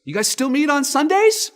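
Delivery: high, loud innocent asking; clueless question. Retold or imitated. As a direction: play it wide-eyed and sincere. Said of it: "He goes".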